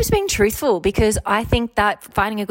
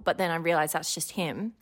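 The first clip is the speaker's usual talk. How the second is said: usual speaking voice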